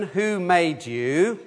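each word spaced out, slow and deliberate; slow recitation. Shown as a demonstration. Leader reading the catechism question to children, slow and deliberate. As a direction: read it out slowly for the children to follow. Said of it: "So here's a question".

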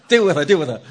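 loud hammed-up demand; loud mock command to a woman worker; mock panicked order. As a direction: bark it like a fussing member. Mock church panic, passing problem.

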